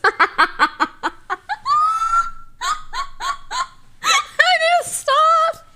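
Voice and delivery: high-pitched through helpless laughter; laughing too hard to talk; real laughing fit. Real, not acted. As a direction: squeeze the words out while cracking up. Cracking up at own mistake.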